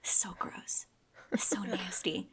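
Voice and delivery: hushed, grossed-out whisper; disgusted whisper. Real, not acted.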